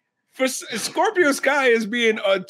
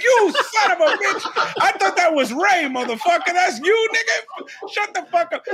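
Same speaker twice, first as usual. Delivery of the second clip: higher, laughing mock rant at the commentator; half-laughing fake fury, pitched up; mock outburst; 8 semitones higher than usual. Fan yelling at the commentator, mock outburst. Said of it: "I'm like".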